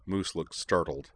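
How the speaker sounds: ordinary delivery, nothing marked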